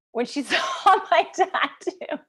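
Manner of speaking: high, drawn-out, laughing through the words. Real, not acted. Retelling how mom cried at the tattoo, laughing. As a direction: break up laughing while telling it. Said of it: "I can't, I laugh now".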